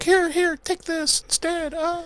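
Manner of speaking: very high, soft mock offering voice; high mock pleading. Co-host imitating a man offering himself to attackers, high mock pleading. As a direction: squeak it out quietly as a mock plea.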